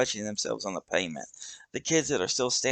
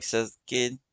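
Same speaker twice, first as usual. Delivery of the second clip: usual speaking voice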